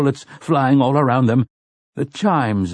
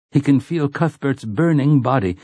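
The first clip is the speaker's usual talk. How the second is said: plain reading voice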